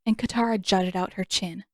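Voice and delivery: usual speaking voice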